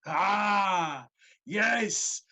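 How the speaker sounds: big loud brute, bellowing; roaring ogre greeting; booming giant voice. Acted. GM voicing giant.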